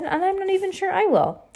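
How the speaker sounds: put-on high, anxious young voice; worried boy. Klaus worrying to Violet about the narrow chute, high. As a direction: let the doubt rise up high. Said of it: "Klaus said".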